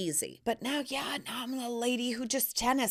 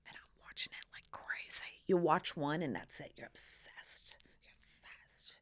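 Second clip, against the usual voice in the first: whisper; 4 semitones lower, 11 dB quieter than usual. Real, not acted. Whispered confession of obsession.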